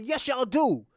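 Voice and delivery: high, loud defiant retort; defiant outburst. Retold or imitated. Girl standing up to the doctors, loud defiant retort. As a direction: snap back at them, standing your ground.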